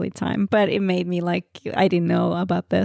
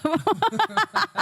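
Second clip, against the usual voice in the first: laughing; 11 semitones higher, 3 dB quieter, slower than usual. Real, not acted. Laughing about mouse voice.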